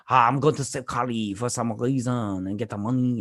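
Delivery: hammed-up French accent, on one long run; mock French villain accent; bad French accent. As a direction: badly imitate a Frenchman. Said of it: "the French guy".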